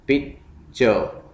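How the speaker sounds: Picture as पिक, pause, चर; split into syllables. Shown as a demonstration. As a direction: break picture into two separate beats. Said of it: "syllables में divide करना है".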